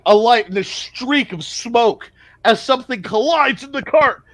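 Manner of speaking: ordinary talk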